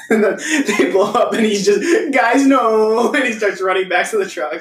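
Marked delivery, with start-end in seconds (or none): 2.1-3.1 high, loud whiny cry; whining voice. Retold or imitated. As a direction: whine it like a panicked man. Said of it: "classic Will Forte whining voice".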